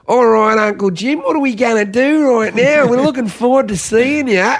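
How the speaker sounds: high-pitched, exaggerated Aussie kids; broad Australian accent. Nephews and nieces greeting their uncle, in a broad Australian accent. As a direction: mimic little relatives in a thick Australian twang. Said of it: "sound so Australian to me".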